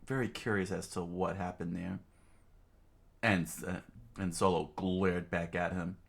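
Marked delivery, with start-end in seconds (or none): none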